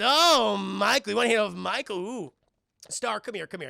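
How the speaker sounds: high, loud taunting call; lightly voiced teasing vampire, calling Star over; mocking vampire tease. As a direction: gang leader showing off to his friends. Vampire boys taunting Michael.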